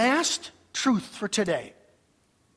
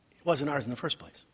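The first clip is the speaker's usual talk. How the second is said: low, soft and quicker; hushed, low-pitched aside; quietly; 7 semitones lower, 7 dB quieter, faster than usual. Real, not acted. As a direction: drop low for the punchline. Soft closing point, then repeated.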